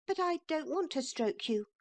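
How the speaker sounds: high, quick little boy pretending not to care; boy's defiant voice. A narrator's reading. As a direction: act brave as a small boy. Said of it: "said Neville".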